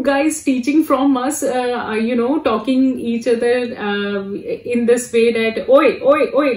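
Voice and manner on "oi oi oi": mock Indian oi call, a bit louder; imitating a call. Showing how Indians call each other, mild mock oi. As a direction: call out oi oi like calling a friend. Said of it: "like we Indians talking each other".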